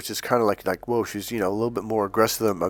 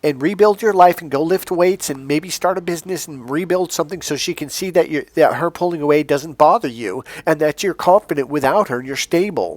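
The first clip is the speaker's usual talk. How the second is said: a higher, mocking take on another coach's advice; mocking other coach; 6 semitones higher than usual. Coach mocking another dating video's advice, higher. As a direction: parody the advice video lightly. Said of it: "like that video I was talking about".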